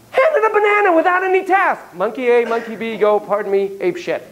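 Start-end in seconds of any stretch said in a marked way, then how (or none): 0.1-1.8 mounting outrage. Real, not acted. Builds to the unfair moment.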